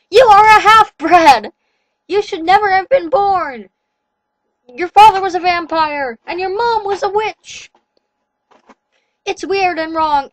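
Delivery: high, loud, slow ranting werewolf; furious rant. A narrator's reading. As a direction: rage at the captive half-breed. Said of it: "He was so angry he was jumping".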